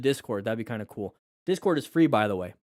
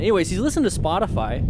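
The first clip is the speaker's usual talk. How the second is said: usual speaking voice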